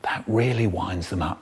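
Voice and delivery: usual speaking voice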